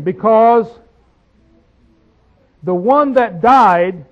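solemn verse reading; slow scripture reading. Acted. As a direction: weigh every word of the verse. Reading verse ten slowly.

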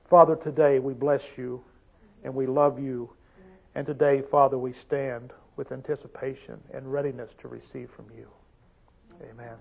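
soft, hushed prayer to the Father. Acted. Preacher opening in prayer to the Father, hushed and reverent. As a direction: pray quietly with reverence.

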